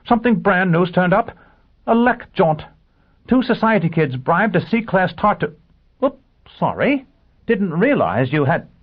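high, loud, breathless-to-tell news; excited. A narrator's reading. Assistant bringing the chief hot news, excited. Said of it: "he shouted in excitement".

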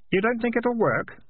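put-on young lad, high and eager; earnest boy Keith. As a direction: lift the voice high, like a lad with news. Keith speaking.

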